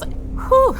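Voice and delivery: sharp high exhale into laughter; high burning whew. Real, not acted. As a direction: blow out the heat, then laugh. Exhaling after hot wing.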